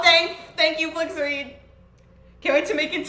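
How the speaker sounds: normal talking voice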